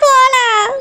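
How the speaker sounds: drawn-out mock wail; fake crying. Wife begging husband to go to her mother, fake crying. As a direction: whine tearfully, stretching the last words. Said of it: "நீ அழாத".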